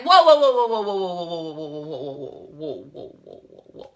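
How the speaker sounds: echoing away into silence; fading whoas. Acted. Mock alarm, fading away.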